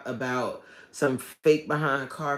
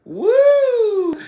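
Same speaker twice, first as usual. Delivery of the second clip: loud, high whoop; 13 dB louder than usual. Real, not acted. Vlogger reacting to the chat with a loud excited whoop. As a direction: let out a big celebratory whoop.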